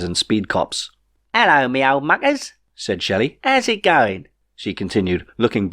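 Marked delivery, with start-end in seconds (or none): 1.3-4.3 Queen Shelley voice. A narrator's reading. Queen Shelley's cockney greeting.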